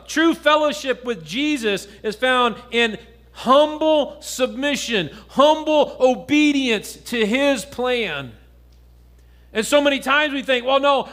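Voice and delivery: earnest pulpit preaching. Acted. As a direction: drive the point home to the congregation. Preaching to congregation.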